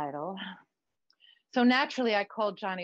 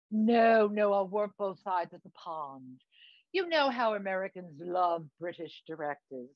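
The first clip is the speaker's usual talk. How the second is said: affected British show-business friend; camp, affected British accent; put-on British accent; 3 semitones lower than usual. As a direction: advise grandly in theatrical British. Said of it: "what's with the accent?".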